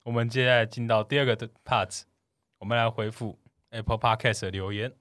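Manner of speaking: ordinary talk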